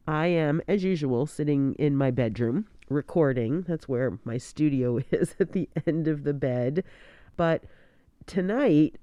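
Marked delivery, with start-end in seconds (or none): none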